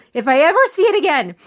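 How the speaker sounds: exasperated repeat. Retold or imitated. Husband sick of the movie.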